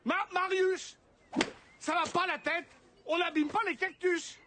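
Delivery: high-pitched telling-off called across the garden; scolding shout. Acted. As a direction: holler at a misbehaving child. Scolds a child from afar.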